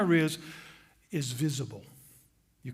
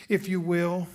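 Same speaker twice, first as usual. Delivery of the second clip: usual speaking voice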